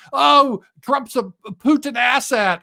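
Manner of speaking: high, loud whiny screech of outrage; mock screech. Neocons crying that Trump is a Putin asset, mock screech. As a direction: screech it like a hysterical pundit. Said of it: "And they all cry and screech".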